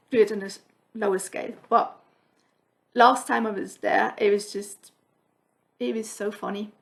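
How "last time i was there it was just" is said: usual speaking voice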